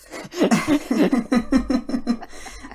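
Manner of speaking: hearty burst of laughter at her own joke; loud laugh. Real, not acted.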